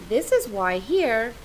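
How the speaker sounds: usual speaking voice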